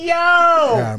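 ordinary delivery

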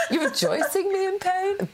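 high laughing mock outrage; giggling you're rejoicing me in pain; laughing tease. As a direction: tease him through laughter. Teasing husband, laughing.

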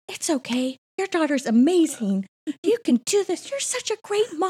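high, sweet mock pep talk; gushing encouragement. Reassuring other frazzled moms, gushing and high-pitched.

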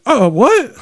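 imitating a startled moviegoer; mock shocked "what?" from a stranger; shocked gasp. Woman in the theater reacting, mock shock. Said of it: "She went".

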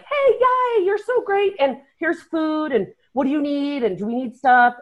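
high-pitched, gushing helper imitation; perky team helper. Team person greeting racers with food, mock perky. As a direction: fuss over them in a high, eager voice.

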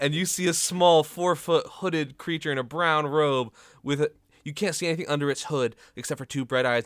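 loud game master narration; excited scene setting. Acted. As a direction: push the creature reveal out with energy. Game master reveals the creature.

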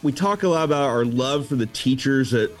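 normal talking voice

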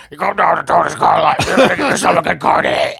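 loud car dealer ad in a rasping RFK voice; brutal RFK-type voice. Retold or imitated. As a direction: sell the car with a wrecked voice. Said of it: "he had this brutal R F K type voice".

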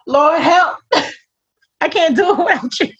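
a hammed-up call for the Lord's help; pleading to God. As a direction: cry out for help, half joking. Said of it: "And I'm like".

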